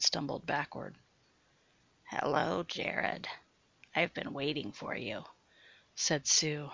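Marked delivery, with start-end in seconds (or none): 2.0-5.2 low, rasping half-whisper; coarse like sandpaper. Acted. Sue greets Jared in the dark basement, rasping low. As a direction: scrape the words out like sandpaper. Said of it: "her voice now coarse like sandpaper".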